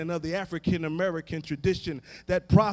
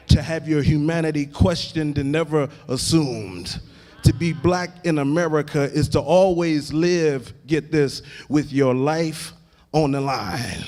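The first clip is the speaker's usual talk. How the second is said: ordinary talk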